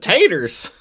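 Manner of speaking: put-on Southern accent. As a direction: imitate his Southern way of saying "taters", amused. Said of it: "because of the way he said".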